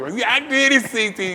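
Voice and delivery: high, loud fake triumph; mock a cheater bragging; mock boastful viewer. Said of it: "You be laying down on the floor".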